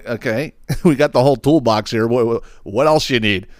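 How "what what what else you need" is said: ordinary delivery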